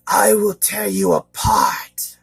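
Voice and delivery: slow, loud, high-pitched monster threat; imitated creature voice, slow and forceful; slitherous monster voice. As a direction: menace like a hydra head, drawn out and high. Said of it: "high pitch or a slitherous voice".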